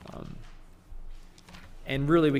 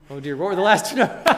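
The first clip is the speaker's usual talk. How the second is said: loud, quick fluster breaking into laughter; flustered laughing; 6 semitones higher, 10 dB louder, faster than usual. Real, not acted. Preacher losing track of his own sums, flustered laughing. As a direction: lose track and crack up, louder and higher. Loses his place in adding.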